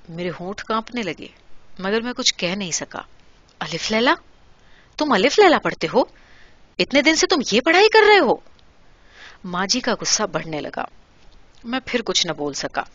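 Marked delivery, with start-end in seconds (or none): 3.6-8.3 building from a sharp question to a much higher, louder shout; a mother's outrage rising to a yell; shocked mother blowing up at her son; thundering anger. A narrator's reading. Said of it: "غصے سے گرجتی ہوئی آواز میں".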